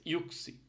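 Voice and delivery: "ইউক্সি" repeated with an initial ইউ; high, careful demo of "ইউক্সি"; Finnish Y sound. Shown as a demonstration. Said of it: "English ইউর মতো".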